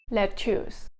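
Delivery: "lettuce" as "let-toos", Singlish accent. Shown as a demonstration. Singlish take of the word.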